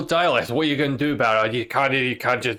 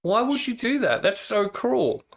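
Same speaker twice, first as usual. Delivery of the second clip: mock dismay; 10 semitones higher than usual. Game master reproaching players who scry on a character, mock dismay. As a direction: plead it in a raised pitch, playfully wounded.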